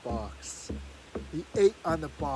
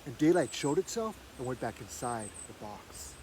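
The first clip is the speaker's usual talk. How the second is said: usual speaking voice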